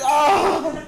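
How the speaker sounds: shrieked laughing ah; high excited squeal. Retold or imitated. Excited friend on the phone, very high squealed ah. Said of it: "that high pitch".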